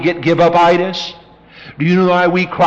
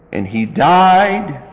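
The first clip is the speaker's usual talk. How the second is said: usual speaking voice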